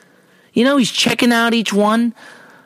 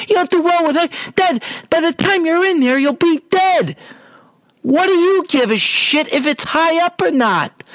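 higher, exasperated disbelief at dad; annoyed retort; 9 semitones higher than usual. Retold or imitated. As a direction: retell your irritation with a raised pitch. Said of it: "I was getting annoyed".

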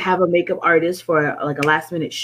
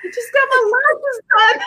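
mock protest; 4 dB louder than usual. Retold protest to sister.

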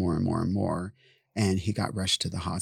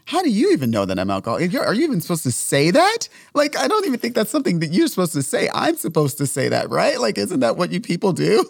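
much higher and louder, fast outraged protest; mock indignant; 11 semitones higher, 7 dB louder than usual. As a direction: fire back, offended and incredulous. Indignant retort to friend's accusation.

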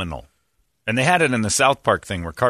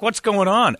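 mock-startled what's going on, pitched up high; startled shout; 11 semitones higher than usual. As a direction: yell it out in sudden alarm. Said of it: "I'm like".

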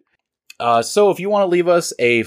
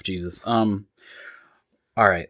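normal talking voice

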